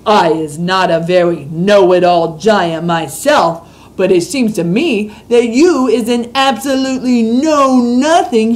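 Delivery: put-on a big friendly giant ticking off the little girl; BFG giant voice. A narrator's reading. Reads the BFG's lines.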